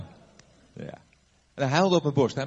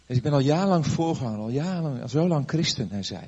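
normal talking voice

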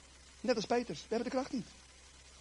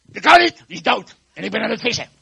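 loud, high, cross refusal; irritable Peter; 4 semitones higher, 14 dB louder than usual. Acted. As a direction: shout him off, fed up. Grumpy Peter snapping at John.

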